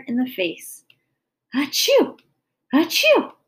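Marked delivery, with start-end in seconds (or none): 1.5-3.3 high, loud "achoo" sneeze; acted sneeze. A narrator's reading. Mother hit in the face with pepper, acted sneezing. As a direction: sneeze twice, bursting upward. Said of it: "She sneezed".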